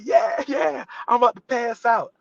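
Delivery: high, giddy near-fainting reply; overwhelmed fan. Retold or imitated. Starstruck extra answering his friends, giddy and high. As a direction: act about to faint from joy.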